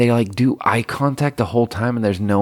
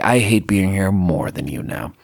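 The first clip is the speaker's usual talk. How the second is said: ordinary talk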